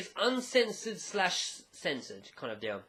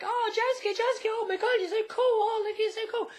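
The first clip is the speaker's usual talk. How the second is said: much higher, giggly fangirl squeal; swooning fangirls; 4 dB louder than usual. Retold or imitated. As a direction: gush over him like swooning schoolgirls. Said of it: "all the girls are like".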